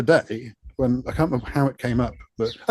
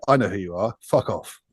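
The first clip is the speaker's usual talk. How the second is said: lower, curt put-down; dismissive brush-off; 4 semitones lower than usual. Retold or imitated. Music insider snubbing the remixer, low curt dismissal. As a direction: brush him off with contempt. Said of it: "And they go".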